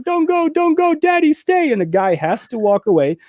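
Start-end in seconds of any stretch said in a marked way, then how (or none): 0.0-1.7 much higher, begging daddy to stay; mock child wailing. Retelling the film, voicing the girl crying for her daddy, high mock wail. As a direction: imitate a sobbing little girl pleading. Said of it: "And then she's like".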